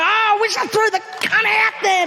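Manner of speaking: high mock shout of dismay; shocked retort. Friend learns Steph ate the rocks, shocked high shout. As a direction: yelp it out in mock horror.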